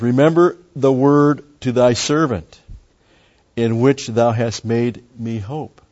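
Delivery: slow, loud, high reading of the verse; solemn scripture reading. Acted. Teacher reading Psalm verse to his Bible class, slow solemn reading. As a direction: intone the psalm like a preacher in the pulpit.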